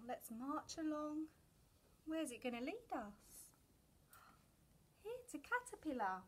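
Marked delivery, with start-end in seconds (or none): none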